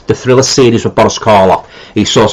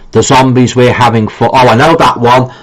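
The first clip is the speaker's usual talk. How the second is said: usual speaking voice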